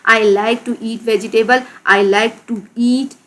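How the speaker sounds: stretched out for the class; unhurried teacher reading; slow example sentence. Shown as a demonstration. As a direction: read it slowly as a model. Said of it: "भी कर सकते हैं यहाँ पर".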